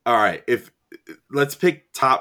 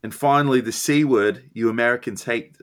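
mock British accent slipping into Australian; put-on Australian-sounding Brit; British accent turned Australian; 2 semitones higher than usual. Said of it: "I went totally Australian there for that last one".

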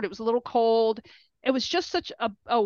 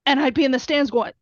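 ordinary talk, nothing marked

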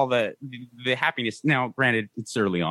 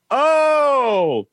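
surprised exclamation; 13 semitones higher, 7 dB louder than usual. Real, not acted. Reacts to the headline.

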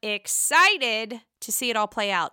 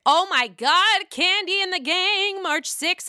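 gushing excitement; 8 semitones higher, 4 dB louder than usual. Real, not acted. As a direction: gush with real anticipation, pitched up. Gushing about upcoming show.

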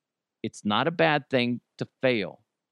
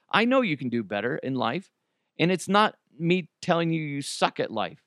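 ordinary talk, nothing marked

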